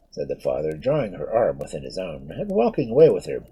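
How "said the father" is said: ordinary delivery, nothing marked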